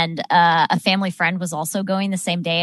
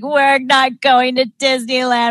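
put-on child's panicked wail; 5 semitones higher, 4 dB louder than usual. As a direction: imitate a distraught small child, drawing it out. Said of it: "like, the child crying in the backseat".

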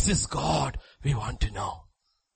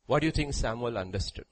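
ordinary talk, nothing marked

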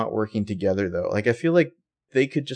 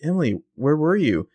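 high, worried questioning; acted concern, voice lifted; 6 semitones higher than usual. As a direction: imitate a worried friend, pitch climbing with each question. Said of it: "She's very concerned".

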